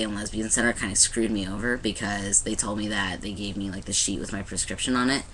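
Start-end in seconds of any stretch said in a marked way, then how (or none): none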